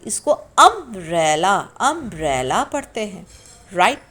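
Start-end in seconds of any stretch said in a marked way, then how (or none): 0.3-2.6 अम्ब्रेला said slowly, starting with a short अ; pronunciation demo. Shown as a demonstration. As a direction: repeat अम्ब्रेला clearly with an uh start. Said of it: "यू अम्ब्रेला नहीं पढ़ते". (अम्ब्रेला धीरे, अ से)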